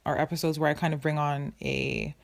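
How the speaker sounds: ordinary talk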